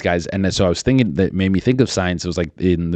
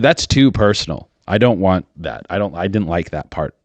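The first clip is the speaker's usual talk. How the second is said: ordinary delivery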